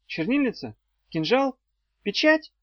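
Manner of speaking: desperate rising questions; frantic guessing. A narrator's reading. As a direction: blurt questioning guesses, pitch rising sharply. Frantic guesses under threat.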